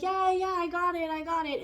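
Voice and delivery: loud, high impatient yeah-yeah; dismissive brush-off. Pretend. You brush off the universe's warning, loud and dismissive. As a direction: wave them off without listening. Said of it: "And you're like".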